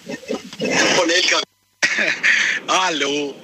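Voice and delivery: slow words over a hands-free line; talking from the truck cab phone; on speakerphone. Real, not acted. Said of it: "Freisprechanlage furchtbar ist". (über Freisprechanlage, langsam)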